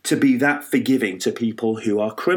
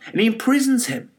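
ordinary talk

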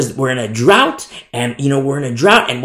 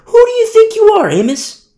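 much higher, louder, fast outrage; indignant outburst; 6 dB louder than usual. Acted. The offended people shouting down Amos, indignant. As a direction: shriek your offence at him. Angry crowd rebuking Amos.

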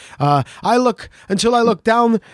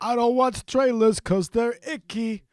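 higher, put-on host voice; mock polished show; 4 semitones higher than usual. Hosts mocking the rival show's current episodes, hammed host voice. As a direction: parody the rival show's new sound. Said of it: "here's what it sounds like now".

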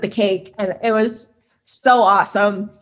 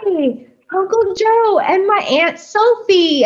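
much higher, slower, lightly voiced little kid; little girl voice; 9 semitones higher, slower than usual. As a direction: sing it out like a small child spotting family. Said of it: "And she goes".

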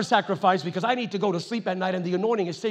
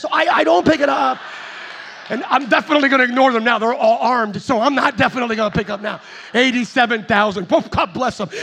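comic loud rant; 9 dB louder than usual. Real, not acted. Comic rant about IRS.

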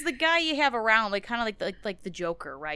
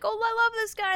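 high, gushing fake delight; mocking fan voice; 14 semitones higher than usual. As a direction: coo it like a fawning fan. Mimicking people laughing at him.

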